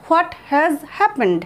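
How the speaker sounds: stretched out word by word; slow it right down. Shown as a demonstration. Teacher contrasting both forms, the second slowly. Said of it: "দুটোই কিন্তু বলা যায়".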